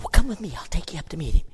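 eager call. Acted. Andrew calling the little boy to come meet Jesus, eager and loud. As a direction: call him along with enthusiasm.

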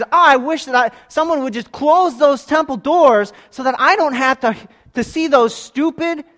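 high, loud, slow divine rebuke; angry God rebuking. Acted. Preacher voices God scolding Israel over worthless sacrifices, loud and high. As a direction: rail at them as an offended God, slowly.

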